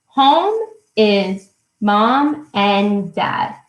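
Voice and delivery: Home... is... mom... and... dad; syllable by syllable. Shown as a demonstration. Teacher reading her haiku line to count, syllable by syllable. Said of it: "Is this five syllables?".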